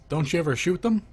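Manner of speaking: ordinary talk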